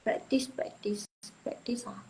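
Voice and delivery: whisper. Real, not acted. Teacher whispering to herself.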